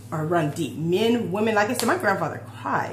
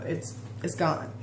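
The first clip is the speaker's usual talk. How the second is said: softer, whispered, trailing off; fading to a whisper; 2 semitones lower, 8 dB quieter than usual. Real, not acted. To viewers, letting the old hurt go, whispered. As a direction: breathe the words out as it lets go.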